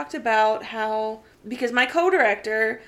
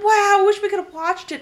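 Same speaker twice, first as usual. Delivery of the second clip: put-on higher eager kid voice; kids wishing; 10 semitones higher, 5 dB louder than usual. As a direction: play the wistful middle schoolers. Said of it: "They're like".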